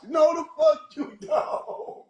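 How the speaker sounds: high, very slow stretched-out refusal; drawn-out scoff. Retold or imitated. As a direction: stretch every word in disbelief. Mocking the seer again.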